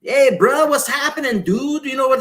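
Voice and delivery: put-on Cajun accent. Shown as a demonstration. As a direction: ham up the Cajun accent for a comedy dub. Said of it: "That's a put on accent".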